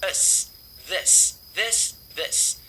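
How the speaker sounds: THIS with a quick, lax i; THIS, brief i and a voiceless s; short sound in THIS. Shown as a demonstration. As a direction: drill THIS keeping the vowel short. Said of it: "é um som curtinho".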